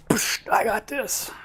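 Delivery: smug and cocky. Pretend. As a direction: brush it off like it's nothing. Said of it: "she's like".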